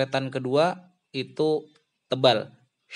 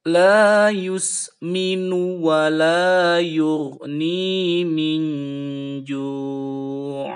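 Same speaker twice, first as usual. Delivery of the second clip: slow loud chant with faint hummed end; slow tajwid chant; Quran recitation; 4 semitones higher, 6 dB louder, much slower than usual. Shown as a demonstration. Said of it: "Sekali lagi".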